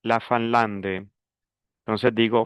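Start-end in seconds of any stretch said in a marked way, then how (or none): 0.0-1.1 "La Finlande" with nasal "fin" and the d heard, no final e; nasal fin, d sounded. Shown as a demonstration. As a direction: say "Finlande" with a nasal first syllable and a clear d. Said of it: "Pronuncio la D, la E final no". ("La Finlande" con "fin" nasal y la D pronunciada)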